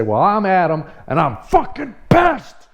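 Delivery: high, strained blow-up on the last words; re-enacted angry outburst; pissed-off introduction. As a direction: introduce yourself, then erupt in slow, high-pitched fury. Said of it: "Just like that".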